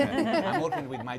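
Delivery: laughing while talking, high and loud. Real, not acted. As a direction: chuckle through the words. Joking about his own accent.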